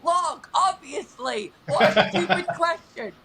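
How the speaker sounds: loud, high, drawn-out imitation through a laugh; hammed-up imitation of his scornful answer, half laughing; mock exasperation. Fan quoting the strongman's reel back to him, mock exasperated. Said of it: "and you're like".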